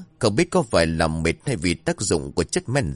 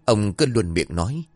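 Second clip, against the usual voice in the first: usual speaking voice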